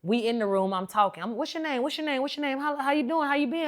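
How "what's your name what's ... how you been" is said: nervous chatter. Retold or imitated. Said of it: "when I'm nervous, chatterbox".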